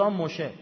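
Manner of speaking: "مشه" as Moshe, with sh for s; Hebrew sh form. Shown as a demonstration. (تلفظ عبری «مشه» با صدای ش)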